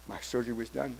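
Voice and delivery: ordinary talk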